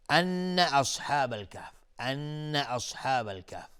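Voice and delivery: slow «ان اصحاب الکهف» with the doubled noon hummed; ghunna on the noon. Shown as a demonstration. Teacher models ghunna twice, noon of «ان» held and hummed. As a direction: hold the noon of «ان» for two counts, then go on to «اصحاب الکهف».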